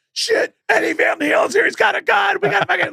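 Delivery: high shouted panic, hammed up; mock panicked shouting. Band members trapped in the house, imitated in a high mock panicked shout. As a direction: squeal it like trapped rockers.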